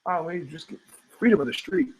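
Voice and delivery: sarcastic innocent tone; mock innocent. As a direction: play it sweet and harmless. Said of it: "one of their phrases I loved the most".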